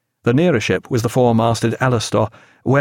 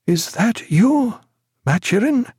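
frightened whisper; 10 semitones higher than usual. A narrator's reading. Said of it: "he whispered".

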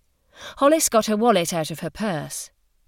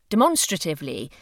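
usual speaking voice

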